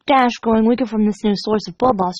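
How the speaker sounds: usual speaking voice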